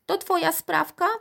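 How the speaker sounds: high, accusing question; calling from another room. A narrator's reading. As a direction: grandmother calling out a suspicion. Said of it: "zawołała z dużego pokoju".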